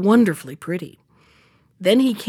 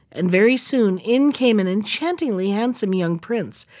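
ordinary delivery, nothing marked

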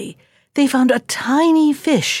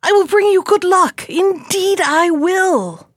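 small high fish voice, eager promise; 8 semitones higher than usual. Acted. Little fish promises the brothers luck, high eager voice. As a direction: coax them earnestly, pitched high.

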